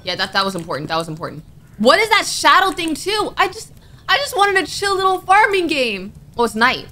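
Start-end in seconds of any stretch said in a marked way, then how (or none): 1.8-6.1 high, aggrieved wail; distressed whining. Real, not acted. Player who wanted a chill farming game, distressed whining. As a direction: complain in a high, rattled voice.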